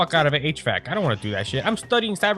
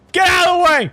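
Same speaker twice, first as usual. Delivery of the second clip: loud, high frustrated yell; real gamer outburst, much louder; angry shout; 13 semitones higher, 6 dB louder than usual. Real, not acted. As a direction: shout it at someone blocking you. Yelling at obstacle in game.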